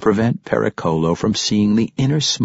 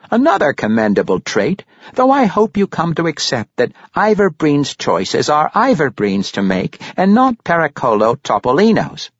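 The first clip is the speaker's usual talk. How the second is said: high, louder grandfather voice; approving Pericolo; 10 semitones higher, 5 dB louder than usual. Acted. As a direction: declare it in character, pitched up.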